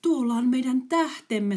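high, loud delighted cry; the girl finds their star; joyful girl. Acted. Girl joins joyful recognition. (iloinen huudahdus)

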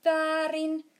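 loud, high shout of wrong; giant shouts. A narrator's reading. Giant shouting at Sohvi's wrong answer, a loud high shout. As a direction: bellow it out, triumphant and cross. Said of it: "huusi jättiläinen".

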